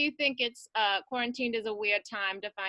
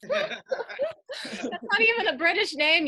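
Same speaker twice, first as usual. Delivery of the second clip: laughing; 10 semitones higher, 4 dB louder, slower than usual. Real, not acted. Laughing mid-rant about a name.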